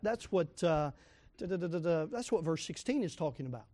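ordinary delivery

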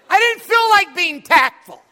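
high, slow, emphatic shout; hammed-up bellow of irritation; untactful outburst. Preacher confesses he had no patience for tact, exasperated shout. Acts out his untactful attitude.

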